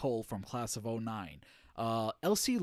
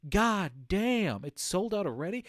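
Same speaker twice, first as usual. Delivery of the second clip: higher, louder exclamation of surprise; retold astonishment, pitched up; mild mock disbelief; 6 semitones higher, 4 dB louder than usual. Streamer reacting to the plush selling out, mildly astonished. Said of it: "I'm like".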